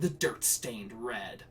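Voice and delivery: ordinary talk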